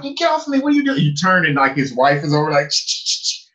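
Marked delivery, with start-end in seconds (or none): 0.0-1.0 high-pitched mock indignation; retold alarmed objection; startled protest. Acted protest at being grabbed. 2.7-3.5 unvoiced repeated shushes; voiceless "shh" sounds; imitated shushing. Imitating wife's noises.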